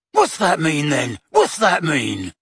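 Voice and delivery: violent mild yell; understated violent yell. A narrator's reading. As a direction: snap the question at him. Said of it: "yelled the Death Eater, suddenly violent".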